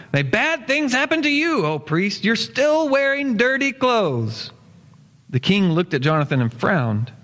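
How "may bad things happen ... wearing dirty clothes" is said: high, slow sneering villain's shout; the evil magician hurls a curse at the priest. A narrator's reading. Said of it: "Malice stood up and shouted".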